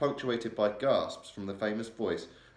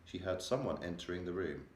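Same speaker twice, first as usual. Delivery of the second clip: usual speaking voice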